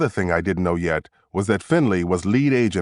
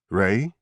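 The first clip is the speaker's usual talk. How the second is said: "Ray" with a rising, unsure lilt; said like a question. A narrator's reading. Dazed suspect giving his name, "Ray" as a question. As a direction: lift "Ray" up at the end as if unsure. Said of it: "He said it like a question".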